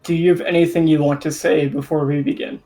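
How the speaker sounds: ordinary talk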